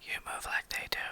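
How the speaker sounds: soft whisper into a lover's ear; whispered sweet nothing. Shown as a demonstration. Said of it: "you whispered it like a sweet nothing into a lover's ear".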